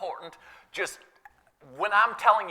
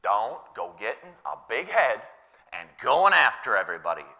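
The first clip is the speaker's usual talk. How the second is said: retold caution; 7 semitones lower than usual. Paraphrasing the apostle's caution.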